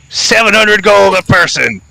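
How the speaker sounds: high-pitched crossbow bandit voice; name your price in a high character voice; demanding toll-keeper. Acted. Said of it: "you hear a voice say".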